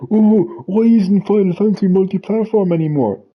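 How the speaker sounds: high-pitched mocking complaint; mock complainer. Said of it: "So people who are like".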